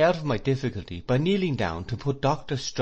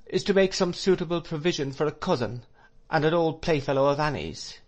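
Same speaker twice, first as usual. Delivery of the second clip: put-on higher-pitched, earnest old doctor; lightly voiced doctor, pitched up throughout; Doctor Strong; 7 semitones higher than usual. A narrator's reading. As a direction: explain it in a raised voice.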